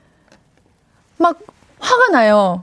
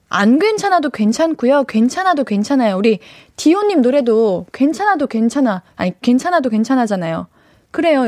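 ordinary delivery

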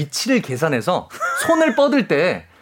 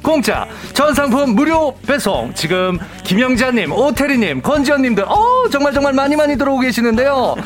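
over eager infomercial; 6 semitones higher than usual. Acted. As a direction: sell it like a TV shopping host. Home shopping host pitch.

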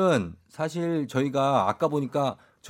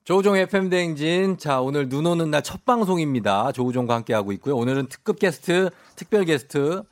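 punchy, louder announcer-style host intro; radio show opener; 4 dB louder than usual. Acted. DJ welcoming listeners back after ads, bright radio announcer delivery. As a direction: kick the show back in with energy.

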